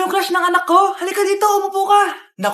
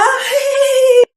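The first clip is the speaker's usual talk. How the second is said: giddy squeal; 7 semitones higher, 4 dB louder than usual. Acted.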